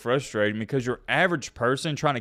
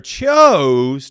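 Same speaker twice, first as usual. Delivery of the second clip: "chose" drawn out with a loud punch; stress on chose; 8 dB louder than usual. Real, not acted. Repeating the statement's key word for viewers, "chose" heavily emphasized. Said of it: "emphasize that word".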